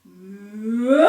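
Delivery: loud bellowing cow moo; bellowed moo. Acted. Belinda snatching the carrot, loud bellowed moo. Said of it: "bellowed Belinda".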